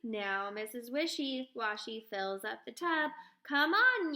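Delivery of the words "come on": louder calling to the animals. A narrator's reading. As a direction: call them over to the tub. Farmer calling animals to bath.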